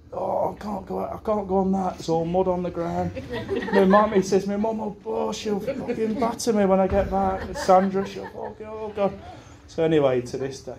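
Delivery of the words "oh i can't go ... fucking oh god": higher, mock whining boy; lightly voiced fretting kid; whiny Gibbo. Mimicking a fretful friend at the fence, whining. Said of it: "Not Gibbo".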